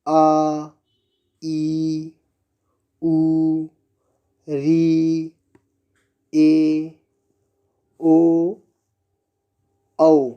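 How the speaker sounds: each vowel आ, ई, उ held long for two beats; the dirgha vowels आ ई उ sung out long, one by one; long vowels drawn out. Shown as a demonstration. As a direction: stretch every vowel out evenly like a teacher. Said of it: "इनकी संख्या देखेंगे".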